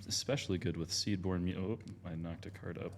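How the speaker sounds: ordinary talk, nothing marked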